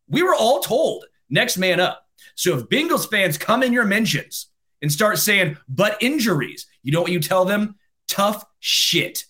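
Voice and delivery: high, gleeful gloating at rival fans. Real, not acted.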